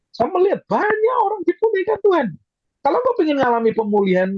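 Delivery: high, forceful preacher's voice; preaching at full force. Real, not acted. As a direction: drive it home high and emphatic. (khotbah berapi-api, nada tinggi)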